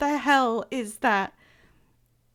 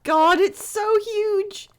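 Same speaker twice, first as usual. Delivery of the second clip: high, loud, slow amazed outburst; 9 semitones higher, 6 dB louder, slower than usual. Real, not acted. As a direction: gasp at something enormous. Reacting to a huge thing.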